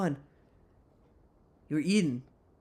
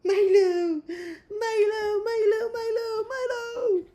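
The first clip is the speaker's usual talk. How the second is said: very high squeals of the name; squealing name; slower than usual. Real, not acted. Squealing the dog's name at him, very high and delighted. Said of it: "And now he's smiling".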